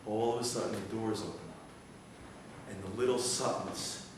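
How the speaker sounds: low, very soft narration with long pauses; hushed suspense storytelling. Acted. Preacher narrates Satan's victory slipping away, hushed suspense. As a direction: draw them in quietly, pause between phrases.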